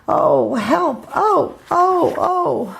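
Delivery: high, panicky mouse cries of help; frightened cries. Acted. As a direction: cry out in mock fright.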